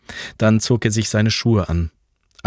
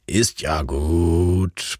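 put-on slow, lower, drowsy grumbling old man; sleepy grumble; 4 semitones lower, much slower than usual. Erich calming his barking dachshund, slow sleepy grumble. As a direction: grumble it half asleep at the barking dog. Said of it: "brummte die noch müde Stimme".

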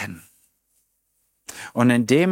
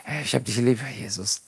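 tender prayer; 2 semitones lower, 6 dB quieter than usual. Pretend. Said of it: "wann platzt das aus mir".

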